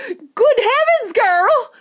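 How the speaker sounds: very high, slow, loud screech; screeching mother. Acted. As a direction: screech in horror. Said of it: "screeched Missus Beauregard".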